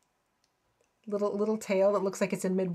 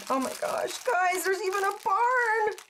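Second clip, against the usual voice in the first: excited; 10 semitones higher than usual. Real, not acted. Excited unboxing discovery.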